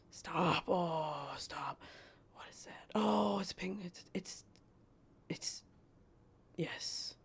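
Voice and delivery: breathy hushed whisper; hush, as if not to scare it off. Real, not acted. Hushed whisper at something seen.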